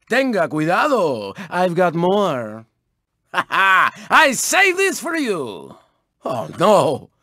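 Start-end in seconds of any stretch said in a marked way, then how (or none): none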